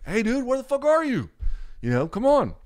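imitating an impatient friend on voicemail; mildly impatient voicemail. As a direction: nag the missing buddy, still half friendly. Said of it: "second one gets a little more like".

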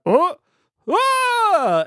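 short high yelps of battle; soldiers' cries. Acted. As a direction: cry out in alarm from offstage. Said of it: "and then you hear a".

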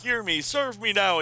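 high-pitched villain command; mock yell. Villain Mordru commanding the teachers, high mock yell. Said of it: "he yells".

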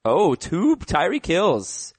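ordinary delivery

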